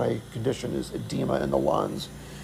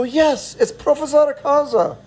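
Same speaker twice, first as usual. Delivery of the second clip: relieved yes; 11 semitones higher than usual. Retold or imitated. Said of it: "We go".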